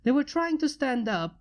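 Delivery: plain reading voice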